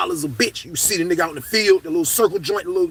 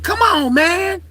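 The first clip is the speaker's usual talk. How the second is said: higher, louder coaxing come on; mild pleading guard; 11 semitones higher, 5 dB louder, much slower than usual. Retold or imitated. Guard coaxing inmates gently, mild raised voice. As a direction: lightly play the softened cop. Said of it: "She started talking like that".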